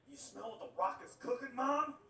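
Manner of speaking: booming Rock catchphrase, high and loud; wrestler catchphrase. Retold or imitated. Kid shouting a wrestling line at mom in the kitchen, hammed-up. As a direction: ham it up like a pro wrestler on the mic. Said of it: "W W F one-liners all day".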